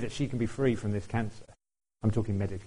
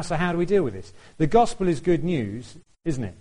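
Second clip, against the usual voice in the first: ordinary delivery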